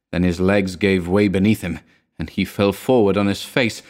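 plain reading voice

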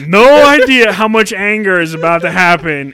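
loud, high acted threat; mock furious warning. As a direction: warn them loudly that you'll blow. Acted threat of coming anger.

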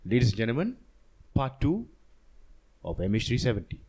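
measured announcer intro with dramatic pauses; demonstrated show introduction. Shown as a demonstration. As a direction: introduce the show, pausing before each phrase. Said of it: "why don't you do it?".

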